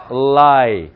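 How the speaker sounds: "Lie" stretched on a clear L; tongue-tip L. Shown as a demonstration. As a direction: hold the L at the start of "Lie". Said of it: "혓바닥 붙여서 출발하는 거".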